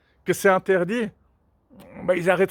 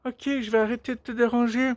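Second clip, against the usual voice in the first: high-pitched mock backing down; hammed-up submissive parent; cowed parent; 5 semitones higher than usual. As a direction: give in at once, placating in a raised voice. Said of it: "Puis les parents qui".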